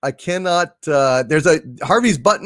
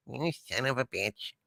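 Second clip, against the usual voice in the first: much softer, low seething curse; quiet seething; 4 semitones lower, 15 dB quieter than usual. Acted. Harvey, alone in his field, curses the poem's sender in a soft low seethe. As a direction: simmer it under your breath.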